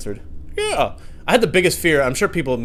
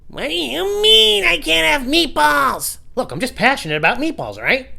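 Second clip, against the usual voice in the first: whiny tantrum; 7 dB louder than usual. Pretend. Petulant man demanding meatballs, high and whiny. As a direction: throw a fit over meatballs.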